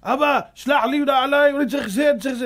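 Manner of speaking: loud, high angry demand; angry shouting son. Retold or imitated. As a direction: bark the demand at father. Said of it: "כולו עצבים". (בן צועק בכעס על אביו)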